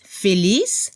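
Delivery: normal talking voice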